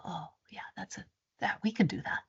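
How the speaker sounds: hushed, almost voiceless embarrassed agreement; sheepish whisper. Retold or imitated. To the contractors, agreeing to divert the path, whispered sheepishly. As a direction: whisper it like you've just been caught out.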